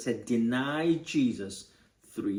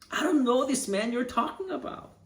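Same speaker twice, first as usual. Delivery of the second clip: much higher, desperate denial; desperate swearing denial; 11 semitones higher than usual. Retold or imitated. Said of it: "So he swears, he curses".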